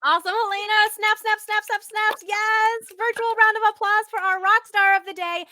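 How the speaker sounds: high, loud celebratory announcement; excited rally of praise; cheering applause. Real, not acted. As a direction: hype up the star guest, high and loud. Host leads virtual applause for guest.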